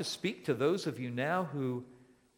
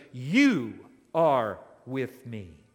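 "you" stressed with a rising lift; "you" pushed up and forward; emphatic you; 8 dB louder, much slower than usual. Shown as a demonstration. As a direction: lean hard on "you". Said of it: "is emphatic".

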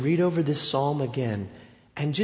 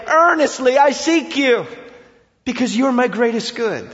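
earnest cry; 6 semitones higher, 4 dB louder than usual. Acted. Preacher voicing earnest prayer.

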